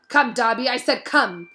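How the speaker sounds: insist, losing patience; impatient order. A narrator's reading.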